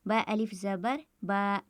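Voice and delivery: slow, then hold the last बा for one alif's length; slow spelled-out recitation. Shown as a demonstration. Teacher spelling out बा for students, stretched slowly. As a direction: spell बा अलिफ ज़बर बा slowly, stretching the final बा a beat or two. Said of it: "इसको एक अलिफ के बराबर खींचकर पढ़ेंगे".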